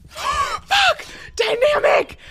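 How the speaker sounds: high, loud cry of shock; startled scream. Real, not acted. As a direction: yelp as you get caught. Caught by a seeker.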